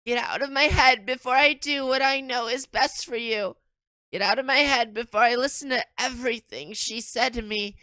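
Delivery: put-on high, loud haunted girl's voice; Sayori's voice. Shown as a demonstration. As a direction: read it high and desperate as her. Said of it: "I gotta read it in her voice".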